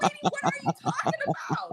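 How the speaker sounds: high, laughing disbelief at her; incredulous scolding. Retold or imitated. Woman scolding the old lady at church, incredulous.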